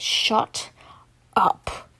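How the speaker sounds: low menacing mutter; deep threatening. Acted. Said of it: "in a deep, threatening tone".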